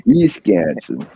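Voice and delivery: slow drawn-out Wisconsin accent. Shown as a demonstration. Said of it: "you don't have a very strong Wisconsin accent".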